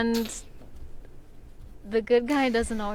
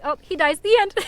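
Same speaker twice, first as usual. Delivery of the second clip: higher, louder flippant ending; mock blunt ending; 6 semitones higher, 8 dB louder than usual. Author mocking her own abrupt ending, higher and louder. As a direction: toss it off, much louder at the end. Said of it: "leave it at".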